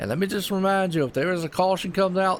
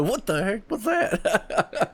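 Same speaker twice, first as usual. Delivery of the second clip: startled awake; 6 semitones higher, much slower than usual. Pretend. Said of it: "you're half asleep".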